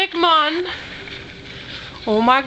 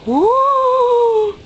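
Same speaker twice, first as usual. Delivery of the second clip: excited whoop; 7 semitones higher than usual. Real, not acted. Owner cheering the cat toy on, excited whoop.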